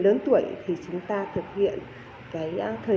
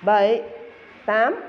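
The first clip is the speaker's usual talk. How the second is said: counting workout reps; 2 semitones higher, much slower than usual. Shown as a demonstration. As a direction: call each beat like a fitness coach. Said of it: "kết hợp đếm nhịp".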